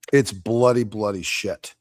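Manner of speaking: ordinary delivery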